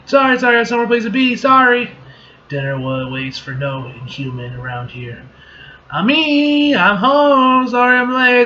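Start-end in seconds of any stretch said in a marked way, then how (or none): 0.1-1.9 fast, high, loud apology; hurried apology. Acted. Excusing herself to leave, hurried and high. Acted rushed exit in comic. 5.9-8.4 high, loud daughterly greeting; calling out home. Acted. Daughter to her mother arriving late, calling out.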